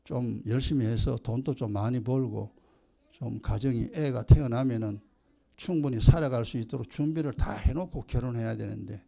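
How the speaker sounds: low, soft, resigned parent; conceding parent. Retold or imitated. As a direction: sigh it out low like a parent admitting fault.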